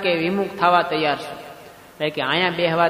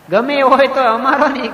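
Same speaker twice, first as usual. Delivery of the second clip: firm insistence; 6 semitones higher, 5 dB louder than usual. Acted. Old father insists on his son.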